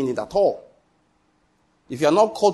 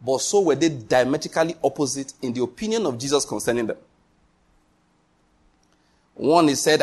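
ordinary delivery

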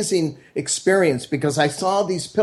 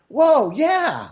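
mock kid's whoa, pitched much higher; childlike awe; 12 semitones higher, much slower than usual. Six-year-old son reacts to dad's wisdom, much higher awed whoa. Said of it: "at six, I'm still like".

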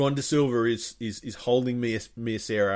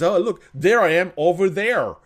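loud, higher-pitched acted quote; mock excited pointing-out; 7 semitones higher, 8 dB louder than usual. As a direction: call it out like spotting someone across the way. Acting out character pointing himself out.

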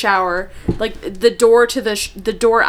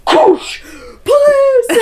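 excited shout; 5 dB louder, much slower than usual. Pretend. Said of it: "we just like".

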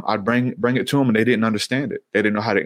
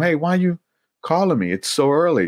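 retold complaint, pitched up; mildly bothered sleeper; 7 semitones higher than usual. Young Samuel to his mentor at night, mildly bothered. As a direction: imitate someone just called awake, higher voice. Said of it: "He's waking up. He's going in the other room".

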